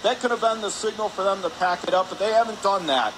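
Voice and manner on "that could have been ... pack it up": ordinary talk, nothing marked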